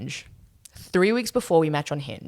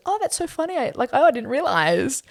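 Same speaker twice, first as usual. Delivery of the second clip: much higher, bright startled girlfriend imitation; high squeaky mock voice; 9 semitones higher than usual. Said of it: "And she goes".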